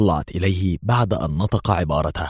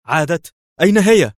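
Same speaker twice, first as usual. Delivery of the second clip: very high, loud, excited questions; enthusiastic cry; 10 semitones higher, 6 dB louder than usual. A narrator's reading. Friend jumping up at news of Mona's return, enthusiastic cry. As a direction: exclaim it, leaping at the good news. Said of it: "وهو يهتف في حماس".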